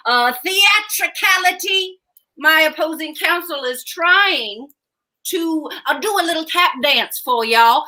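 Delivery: theatrical drawling prosecutor; dramatic lawyer. Acted. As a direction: play to the jury, big and showy. Courtroom parody objection.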